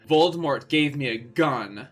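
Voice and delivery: usual speaking voice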